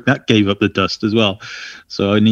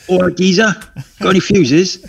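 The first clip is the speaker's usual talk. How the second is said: Cockney geezer, pitched higher and louder; hammed-up Cockney; 10 semitones higher, 4 dB louder than usual. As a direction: play up the Cockney bloke asking for fuses. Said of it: "a little bit Cockney".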